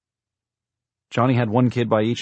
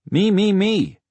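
put-on very high, loud little-girl clamour; bugled child's cry; 14 semitones higher, 8 dB louder, slower than usual. A narrator's reading. Impatient six-year-old wanting her turn, bugling loudly. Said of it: "Rachel bugled".

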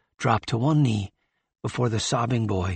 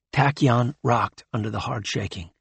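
ordinary delivery, nothing marked

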